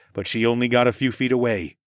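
usual speaking voice

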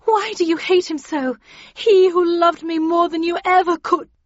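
very high, loud melodramatic heroine; impassioned, high-pitched lover's outburst; Catherine crying out. A narrator's reading. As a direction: wail in protest at her husband. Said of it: "cried Catherine".

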